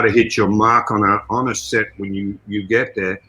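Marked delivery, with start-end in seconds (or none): none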